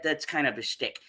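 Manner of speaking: usual speaking voice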